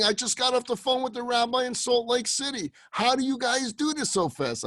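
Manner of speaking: high, agitated voice of disbelief; freaking out. Retold or imitated. Woman to rabbi about the fast delivery, freaking out. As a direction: imitate someone freaking out, pitched well up. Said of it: "And she's freaking out".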